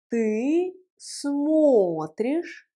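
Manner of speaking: extremely slow, long held vowels; slow model pronunciation. Shown as a demonstration. Teacher leading a repeat-after-me conjugation, very slow. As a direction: draw each word out for learners. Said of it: "Prononçons ensemble la conjugaison".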